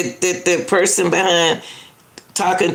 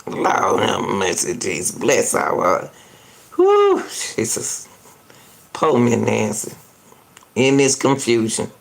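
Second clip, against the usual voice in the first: exasperated lament; 10 semitones lower than usual. Real, not acted.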